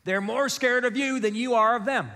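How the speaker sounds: high, lilting mom voice; high-pitched, emphatic motherly assurance; imitated mom. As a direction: mimic a mother lecturing her boys. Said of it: "That's exactly how she talks".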